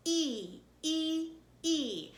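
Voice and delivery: E drawn out as in eel; long E sound. Shown as a demonstration. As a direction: hold E as the letter name. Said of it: "The letter E says".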